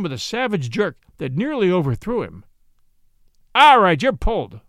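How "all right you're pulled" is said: louder, rough collar; gruff arrest. Acted. Policeman arresting Watson, gruff and louder. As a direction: grab him and bark it.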